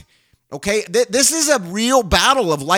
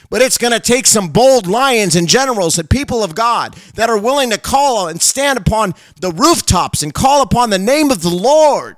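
fiery preaching; 5 semitones higher than usual. Real, not acted. A preacher rallying the church to rise up, fiery. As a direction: rouse the church like a revival preacher. Preaching at full force to listeners.

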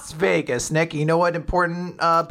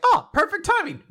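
bright high sing-song, much higher; chirpy high quote. Retold or imitated. Fuko gleefully seizing the moment, chirpy high voice. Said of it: "And Fuko's like".

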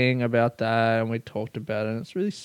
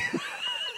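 burst of laughter; 16 dB quieter than usual. Real, not acted. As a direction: crack up with a shrieking laugh. Laughing at own story.

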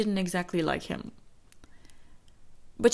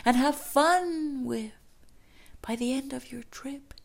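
higher, breathy dreamlike coaxing; friend's ringing voice; 4 semitones higher than usual. Retold or imitated. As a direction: coax like a voice echoing in her head. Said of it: "she heard her friend's voice ringing in her ears".

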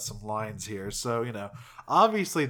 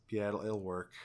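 very quiet; 4 semitones lower, 7 dB quieter than usual. Retold or imitated. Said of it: "Very, very quietly".

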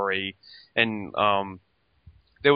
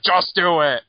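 louder, higher curt command; hammed-up Bruce snapping an order; mock Bruce order; 8 semitones higher, 8 dB louder than usual. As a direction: bark it as a bossy mentor. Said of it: "And Bruce is like".

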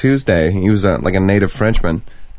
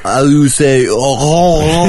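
mock French accent; 4 semitones higher, slower than usual. Mocking French speech.

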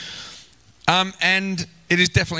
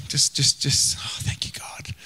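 breathy, unvoiced thanks barely above a breath; hushed private gratitude to God; awed whisper; 3 semitones lower, 4 dB quieter than usual. Real, not acted.

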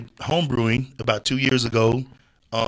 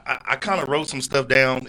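ordinary talk, nothing marked

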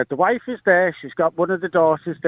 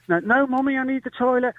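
higher-pitched imitation of a child; pleading little daughter; 6 semitones higher than usual. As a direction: lightly act out a child needing Mummy. Daughter pleading with distracted mother.

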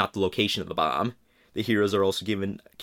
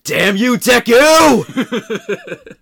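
building frantic yell, higher and louder; rising hysterical meltdown; panicked rant; 10 semitones higher, 11 dB louder, slower than usual. Retold or imitated. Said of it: "I can imagine him going like".

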